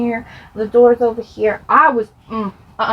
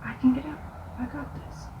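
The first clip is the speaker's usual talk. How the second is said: breathless whisper, barely voiced; whispered self-talk; 2 semitones higher, 14 dB quieter than usual. Hiding in a closet from her brothers, she whispers. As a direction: whisper it to yourself, steeling up. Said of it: "And I'm like".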